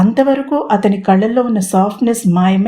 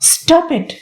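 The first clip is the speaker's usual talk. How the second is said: sharp, higher cry cutting him off; angry shout; 8 semitones higher than usual. A narrator's reading. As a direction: snap at him to stop, pitched high. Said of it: "కోపంగా అరిచింది నర్మద".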